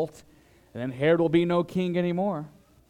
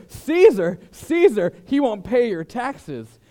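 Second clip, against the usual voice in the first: much higher, louder tattling cry; hammed-up informer shouting to Caesar; mock alarm; 7 semitones higher, 7 dB louder, slower than usual. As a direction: run crying to Caesar with an accusation. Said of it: "they go".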